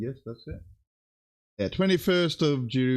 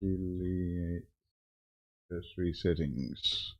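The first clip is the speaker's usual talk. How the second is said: muttering to himself; 6 semitones lower, 10 dB quieter than usual. Real, not acted. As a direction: mumble the menu name under your breath. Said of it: "reading settings to himself".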